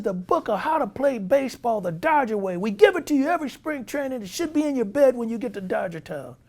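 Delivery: high, loud exasperated manager; high, angry manager voice. Retold or imitated. Manager berating his players over the book, high and loud. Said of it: "He says".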